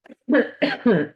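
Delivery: sudden dry cough from a tickle in the throat; a sharp cough cutting off the sentence; real coughing fit. Real, not acted. Host breaks off her forecast to cough. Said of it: "little tiny dry spots in your throat".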